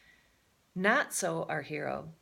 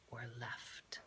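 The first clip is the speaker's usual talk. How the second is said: whispered aside; 6 semitones lower, 17 dB quieter than usual. A narrator's reading.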